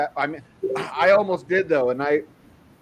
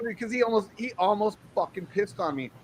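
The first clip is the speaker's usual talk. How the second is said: usual speaking voice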